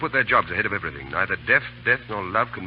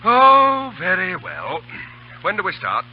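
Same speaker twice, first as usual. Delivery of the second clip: breezy mock consent; 10 semitones higher, 10 dB louder, slower than usual. Cheerfully joining a risky plan.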